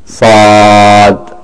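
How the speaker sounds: Saad with the long aa held for two counts; stretched Saad. Shown as a demonstration. Tajweed teacher demonstrates the minimum stretch on Saad. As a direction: draw out Saad slowly, two alifs long. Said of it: "stretch it equal to two alifs".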